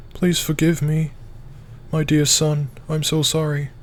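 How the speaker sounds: high, slow, pleading frail mother; quivering weak mother. Acted. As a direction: beg forgiveness in a trembling voice. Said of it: "Mum's quivering and weak voice".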